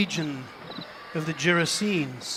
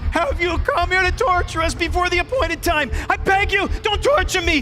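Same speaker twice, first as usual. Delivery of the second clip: much higher, louder, desperate begging; shouted pleading; 11 semitones higher, 4 dB louder than usual. Acted. Demons begging Jesus not to torture them, shouted. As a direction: beg for mercy at the top of your voice. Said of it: "They shouted".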